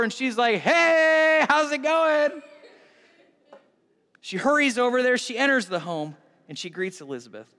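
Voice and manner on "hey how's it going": loud, high, drawn-out greeting; calling out hello. Acted. Mary popping in at Elizabeth's door, hollering hello. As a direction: holler it as you burst in. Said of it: "she pops open the door".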